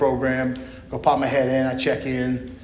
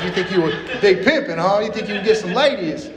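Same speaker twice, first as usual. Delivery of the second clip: teasing; 6 semitones higher than usual. Retold or imitated. Counselor teasing him.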